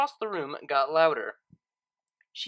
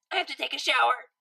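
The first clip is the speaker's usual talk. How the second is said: hurried, higher call from the next room; 8 semitones higher, 3 dB louder, faster than usual. Acted. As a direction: call it over your shoulder as you rush off. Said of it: "she called".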